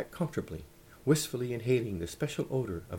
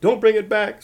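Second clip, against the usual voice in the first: high, very loud shriek; screamed; 11 semitones higher, 14 dB louder than usual. A narrator's reading. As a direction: scream it after him as Grandma. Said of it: "screamed Grandma".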